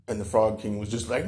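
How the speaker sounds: plain reading voice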